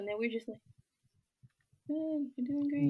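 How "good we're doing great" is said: high, slow, put-on politeness; awkward polite reply. New Yorkers answering a very friendly southerner, awkwardly polite imitation.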